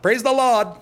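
high, loud mock yell from the crowd. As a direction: call it out like an excited concertgoer. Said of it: "this guy kept yelling out".

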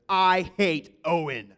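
loud, slow mock student voice; fed-up student. A student at the classroom door, loud and slow. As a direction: drag it out like a kid walking into class. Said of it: "and one kid goes".

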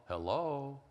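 "Hello" sung out in three gliding tones; imitated father-in-law's three-tone phone "Hello". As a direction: glide "Hello" through three notes. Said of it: "sort of a three-tone hello".

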